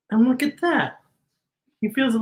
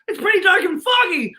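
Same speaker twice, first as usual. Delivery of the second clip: excited spooky; 9 semitones higher than usual. Shown as a demonstration. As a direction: build the spooky excitement for the child. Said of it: "Remember fog, J J?".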